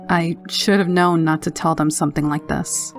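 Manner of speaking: ordinary delivery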